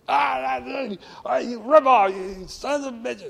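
high-pitched furious yelling in an imitated old man's voice; angry outburst. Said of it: "he hit the roof".

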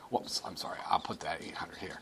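soft, breathy whisper to himself; half-whispered apology to the class; whispered aside. Real, not acted. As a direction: mutter the correction under your breath. Muttered aside while writing on board.

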